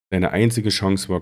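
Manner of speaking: plain reading voice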